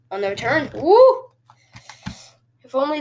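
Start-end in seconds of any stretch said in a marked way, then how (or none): 0.7-1.3 short, high, loud woo; excited whoop. Real, not acted. Gamer watching the kick return, excited whoop. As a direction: let out a quick high whoop.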